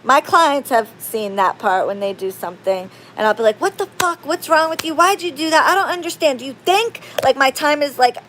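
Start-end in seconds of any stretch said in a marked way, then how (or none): 3.6-8.2 fast, higher-pitched indignant rant; retold angry tirade, pitched up; going off angrily. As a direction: rattle off outraged questions. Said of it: "If I'm, like, screaming, like, and going off".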